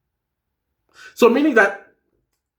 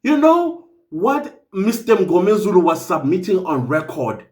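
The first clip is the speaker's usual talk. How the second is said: normal talking voice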